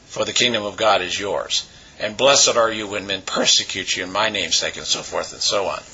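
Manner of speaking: normal talking voice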